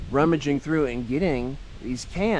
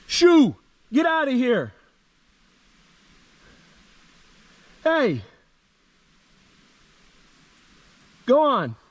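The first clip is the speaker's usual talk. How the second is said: high, loud shouts at the bear; scaring off a bear; 12 semitones higher, 5 dB louder, slower than usual. Real, not acted. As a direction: yell to chase it off.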